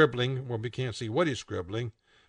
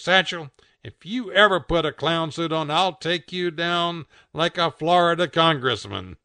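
higher, louder blustering warning; comic threat; 5 semitones higher, 6 dB louder than usual. A narrator's reading. Bucky warns Satchel about clown suits, blustering. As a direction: threaten with mock ferocity.

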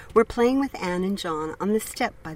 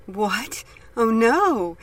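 higher, sudden alarmed cry; 5 semitones higher, 5 dB louder, much slower than usual. A narrator's reading. Mother hears the dog bit a child, alarmed cry. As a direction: gasp out the shock.

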